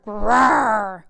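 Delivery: a rumbling animal grrr; bear roar. A narrator's reading. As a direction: growl it out like a big bear. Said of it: "Old Bear roared".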